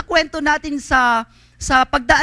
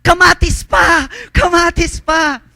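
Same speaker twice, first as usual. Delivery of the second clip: slow, loud, high calling for more; excited shout; 6 semitones higher, 7 dB louder, slower than usual. Retold or imitated. Leader moved by the lepers, shouting for more tomatoes. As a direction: call out joyfully for more tomatoes. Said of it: "sabi niya".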